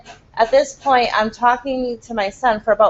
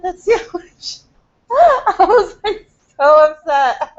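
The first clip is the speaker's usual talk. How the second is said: laughing in disbelief; 6 semitones higher, 4 dB louder than usual. Real, not acted. Laughing at the absurd sandwich.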